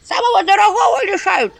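high loud frantic calling for help; panicked cries. Acted. House spirit screaming for help, hiding behind the stove. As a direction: scream as if robbed.

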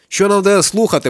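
high, loud indignant question; indignant outburst. A narrator's reading. Dog interrupts Kakao, loud indignant question. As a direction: burst out in protest. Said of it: "починає собака".

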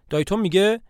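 ordinary delivery, nothing marked

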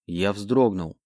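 usual speaking voice

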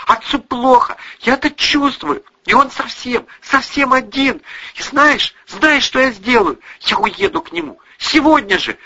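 high, urgent, heated daughter; agitated outburst. A narrator's reading. Daughter announces leaving for lonely father, agitated. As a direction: insist passionately, pitch held high.

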